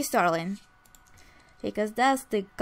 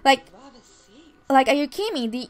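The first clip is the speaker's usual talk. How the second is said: arguing; 6 dB louder than usual. Acted. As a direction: throw it back at her, indignant. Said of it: "argue, like".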